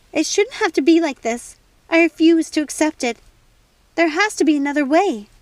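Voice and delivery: loud, high, furious defiance; angry refusal. A narrator's reading. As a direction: refuse it with all your anger. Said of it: "angrier than she'd ever been".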